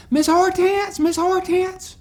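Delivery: high, loud, whining call for the teacher; whiny girl calling. Retold or imitated. Marilyn whining loudly to get the teacher's attention. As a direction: wail the teacher's name like a tattling kid. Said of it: "Marilyn grew out of her whining".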